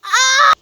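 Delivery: sharp yelp of disgust; startled shriek. Real, not acted. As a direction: cry out in shock. Loud shriek after opening.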